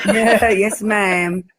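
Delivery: mock American drawl, yes ma'am; American accent. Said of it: "That's my best American accent".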